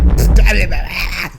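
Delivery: loud spluttering laugh; sudden high cackling outburst; burst of laughter. Real, not acted. Host reacting to Mean Girls, loud burst of laughter.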